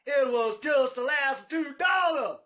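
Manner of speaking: high, loud, slow punchline; slowed mock-horrified yell; outraged shout. Real, not acted. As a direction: shout it out in comic horror. Horror punchline.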